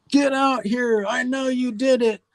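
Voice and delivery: retold maniac yell. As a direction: imitate a ranting neighbour, pitched well up. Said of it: "out here in the driveway yelling".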